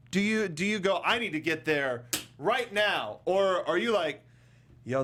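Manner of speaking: ordinary talk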